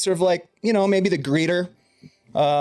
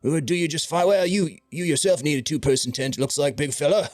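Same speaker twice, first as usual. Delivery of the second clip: a fussing merchant eager to help; fussy shopkeeper Gremag. Acted. GM voices Gremag selling tent.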